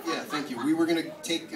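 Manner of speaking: normal talking voice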